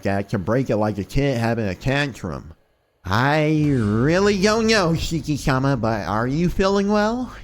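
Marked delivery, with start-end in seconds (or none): 3.1-7.3 lightly put-on female servant, hesitant and concerned; concerned maid. Maid asking if her master is feeling well, hesitant concern.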